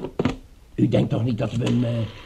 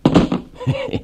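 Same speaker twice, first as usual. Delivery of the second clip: short, high, gleeful chuckle; gloating laugh. Acted. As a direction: laugh over a helpless victim. Nico gloats over unconscious man.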